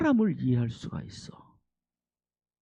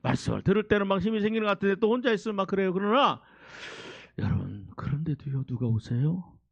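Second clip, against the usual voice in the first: usual speaking voice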